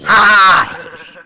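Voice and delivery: short, high loud cry; death yell. Pretend. Player dying in the game, loud high yell. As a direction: yell as the game character gets killed. Cries out as character dies.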